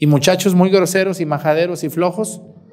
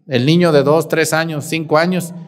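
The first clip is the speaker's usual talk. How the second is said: usual speaking voice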